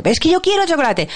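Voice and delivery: imitated child's protest; insistent child. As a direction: whine for a treat like a child. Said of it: "Alonso me dice que quiere comer chocolate".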